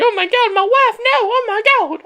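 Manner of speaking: high-pitched mock panic, held on one shrill note; The Miz. Commentator voicing a shocked husband watching his wife, high squealed impression. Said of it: "why that's The Miz's voice".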